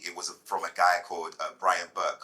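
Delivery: normal talking voice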